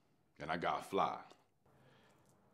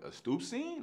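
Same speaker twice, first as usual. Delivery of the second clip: surprised; 13 semitones higher, 4 dB quieter than usual. Retold or imitated. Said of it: "So I was like".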